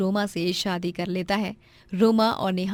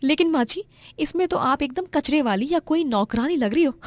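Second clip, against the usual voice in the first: snobbish daughter-in-law; 6 semitones higher than usual. Acted. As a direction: look down on her mother-in-law. Narrator voices snobbish Roma. (सास का मज़ाक उड़ाती घमंडी बहू)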